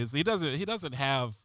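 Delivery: usual speaking voice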